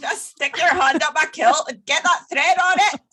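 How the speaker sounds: loud, high mock-Scottish voice; hammed-up kilted Scot giving orders; Scottish accent. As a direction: bellow it like a comic Scotsman. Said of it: "Is that your Scottish accent?".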